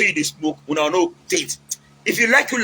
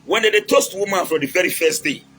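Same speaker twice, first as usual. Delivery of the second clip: normal talking voice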